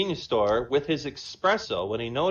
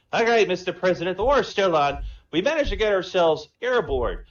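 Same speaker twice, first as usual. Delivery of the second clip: higher, louder Irish brogue; Irish accent; 6 semitones higher, 6 dB louder than usual. Acted. Paddy the Irishman phoning the president, Irish accent. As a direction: play a cocky Irish pub man. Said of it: "Irish and Jamaican".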